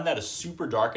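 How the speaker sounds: ordinary talk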